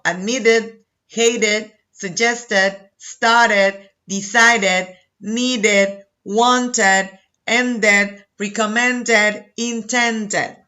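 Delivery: ADMITTED through INTENDED, each -ed an extra 'id' syllable; word-by-word model list, sounding 'id' on ADMITTED, HATED and the rest to INTENDED. Shown as a demonstration. Teacher modelling T or D verbs, every ending from ADMITTED to INTENDED said 'id'. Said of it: "the pronunciation of E D is it".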